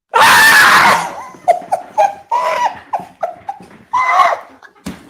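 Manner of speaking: high, screaming burst of laughter; real hysterical laugh; shrieking laugh. Real, not acted. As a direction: cackle in disbelief at the miss. Penalty miss, stunned celebration.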